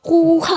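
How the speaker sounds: playful loud martial-arts yell; mock karate "hoo-ha"; karate cry. A narrator's reading. Playful karate cries to show off. (मज़ाकिया karate वाली आवाज़)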